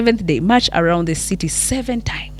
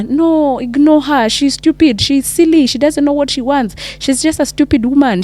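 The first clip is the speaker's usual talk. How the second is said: higher, louder dismissive outburst; scornful parents; 8 semitones higher, 4 dB louder than usual. Acted. Parents scoffing at Rahab before Joshua, scornful and loud. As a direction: brush her off with contempt.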